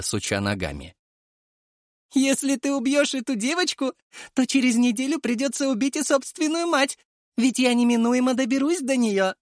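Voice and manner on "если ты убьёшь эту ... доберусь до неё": high, mocking creature voice; sneering worm king voice. A narrator's reading. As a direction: voice an amused parasite villain. Villain threatens mother.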